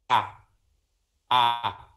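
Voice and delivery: low "a", tongue unmoving; open A syllable. Shown as a demonstration. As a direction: voice a plain open "a" with no consonant attack. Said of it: "não, não movimenta".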